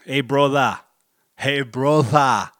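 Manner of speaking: loud drawn-out brotha, slow at the end; mock brotha voice. Mocking how the spelled word sounds, loud and slow. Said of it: "it goes".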